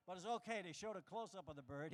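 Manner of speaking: normal talking voice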